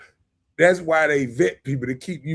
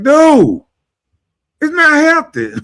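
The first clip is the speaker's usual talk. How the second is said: exasperated exclaim; 11 semitones higher, 9 dB louder than usual. Retold or imitated. Retelling his exasperated reply.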